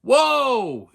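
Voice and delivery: loud, high, drawn-out exclamation; outraged whoa. Real, not acted. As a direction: cry out to halt the whole scene. Outburst at the retold rip-off.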